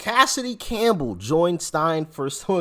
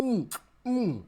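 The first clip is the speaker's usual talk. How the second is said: water held in mouth; 9 semitones higher than usual. Real, not acted. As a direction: mouth full of water, mumbling. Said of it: "I just spit out my water".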